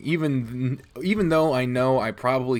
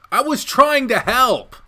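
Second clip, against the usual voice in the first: much higher, louder, slow plea; defensive whine; 12 semitones higher, 7 dB louder, slower than usual. Acted. Protagonist defends himself to Shuichi, high defensive plea. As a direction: protest that you only meant well.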